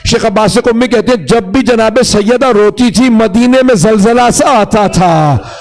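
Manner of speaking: high, urgent majlis narration; fervent lament preaching; impassioned mourning sermon. Acted. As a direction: recount the grief at a high pitch.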